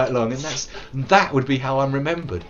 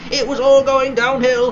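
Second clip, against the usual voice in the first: high, wailed complaint; playful loud despair; loud lament; 11 semitones higher, 8 dB louder than usual. Real, not acted. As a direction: cry it out loudly.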